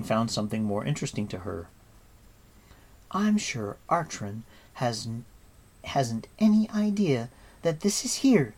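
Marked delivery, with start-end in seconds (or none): none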